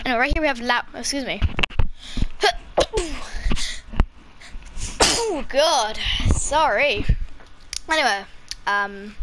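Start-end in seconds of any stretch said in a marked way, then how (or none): 1.5-4.1 a harsh real coughing fit. Real, not acted. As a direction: cough hard, caught off guard. Coughing mid-sentence.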